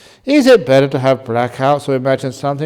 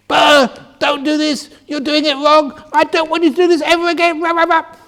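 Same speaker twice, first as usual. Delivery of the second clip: much higher, louder, fast staged scolding; mock angry scolding; 7 dB louder than usual. Shown as a demonstration. Teacher demonstrating how angry people sound, hammed-up shouting. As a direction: bark it out like an irate scold, pitch way up. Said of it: "you can see my pitch went up, and I was speaking very fast".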